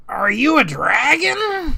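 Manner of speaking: high, loud little creature voice; squeaky kobold awe. Acted. GM voices timid kobold asking.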